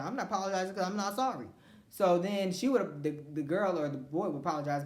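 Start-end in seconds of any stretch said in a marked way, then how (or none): 0.0-1.5 defiant refusal. Retold or imitated. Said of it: "I said".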